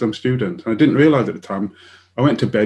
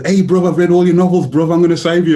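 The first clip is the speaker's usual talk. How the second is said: put-on loud, higher, breezy young doctor voice; 5 semitones higher, 6 dB louder than usual. Quoting the young doctor to the interviewer, loud breezy voice. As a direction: mimic a cocky young doctor.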